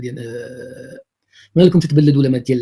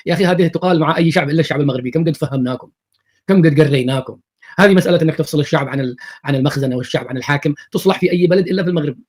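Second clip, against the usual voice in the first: normal talking voice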